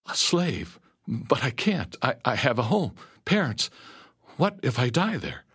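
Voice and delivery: high, pleading stammer; distraught disbelief. A narrator's reading. Captive told he'll be a slave, pleading in alarm. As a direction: protest in rising panic.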